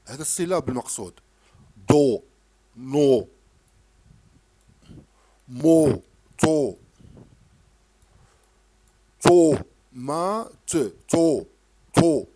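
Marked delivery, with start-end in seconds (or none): 1.9-12.3 each syllable called out much louder, one by one; loud drilled syllables. Shown as a demonstration. Teacher drilling a child on syllables, loud and separate. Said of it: "هذا الصوت المقصود".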